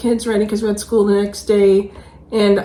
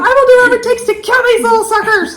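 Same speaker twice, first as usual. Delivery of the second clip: very fast, high and loud laughing vow; laughing determination; 9 dB louder, much faster than usual. Retold or imitated. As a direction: swear it through laughter, determined. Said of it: "I'm like".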